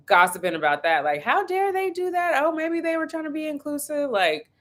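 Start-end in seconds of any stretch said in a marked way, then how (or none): 1.2-4.1 high, scandalized auntie gossip voice; mock-shocked family gossip. Imitating the aunties gossiping, higher and scandalized.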